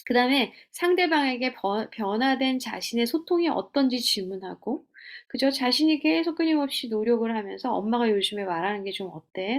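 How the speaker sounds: ordinary talk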